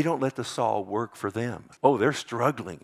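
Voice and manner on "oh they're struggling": normal talking voice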